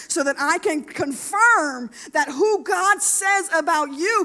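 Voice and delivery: loud, high, emphatic climax; impassioned preaching. Real, not acted. Preacher assuring a woman that God cares, impassioned preaching. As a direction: proclaim it with full force.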